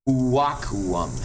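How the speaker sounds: "uaquum" as WAH-koo-oom, final U's as vowels; W then vowel U's. Shown as a demonstration. Teacher showing which U's became vowels, "uaquum" as WAH-koo-oom. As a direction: sound both closing U's in "uaquum". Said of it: "last two U's became vowels".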